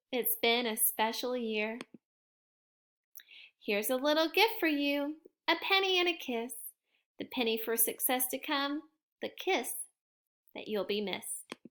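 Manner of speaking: soft, fading teacher goodbye; tender farewell. Acted. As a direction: speak gently to the class.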